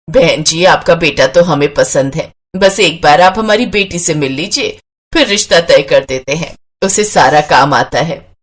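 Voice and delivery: low-pitched matchmaking mother; mother of the bride. Acted. Mother talks to groom's family. (माँ की नीची आवाज़ में)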